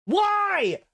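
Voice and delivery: high, loud exasperated yell; exasperated outburst. Real, not acted. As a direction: cry out in disbelief. Exasperated at the British pronunciation.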